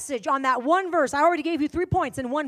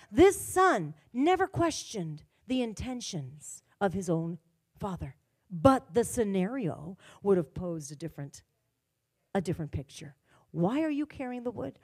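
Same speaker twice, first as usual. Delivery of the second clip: lower, softer, slow telling of Abraham and Isaac; soft scripture storytelling; 4 semitones lower, 5 dB quieter, slower than usual. Acted. Preacher telling the story of Abraham and Isaac, softer. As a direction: draw the listeners in gently.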